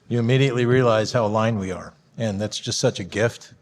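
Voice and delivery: normal talking voice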